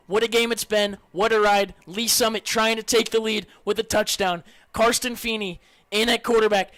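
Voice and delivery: normal talking voice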